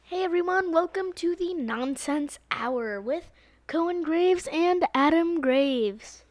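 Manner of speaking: loud, slow host intro; podcast intro voice. Pretend. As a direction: announce the show with gusto. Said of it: "You wanna do a promo?".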